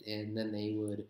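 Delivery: ordinary talk, nothing marked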